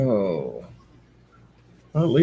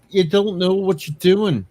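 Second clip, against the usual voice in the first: ordinary talk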